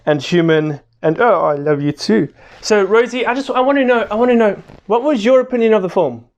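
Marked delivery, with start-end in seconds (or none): 2.6-6.2 higher-pitched playful questioning; playful interviewing. Real, not acted. Reviewer asks Rosie for an opinion on the film, playful. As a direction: put the question up high, coaxing an answer.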